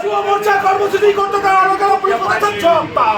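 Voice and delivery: fast, shouted protest slogan; rally the crowd with a shouted chant. Real, not acted. Protest leader leading slogans.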